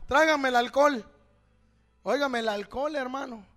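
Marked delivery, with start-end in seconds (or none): 0.1-1.0 loud, raised-pitch call; acted demand. Preacher plays patient asking for alcohol, calling out. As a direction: call out the order higher and louder.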